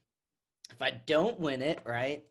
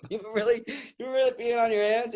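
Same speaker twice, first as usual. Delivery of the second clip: retold disbelief; 8 semitones higher than usual.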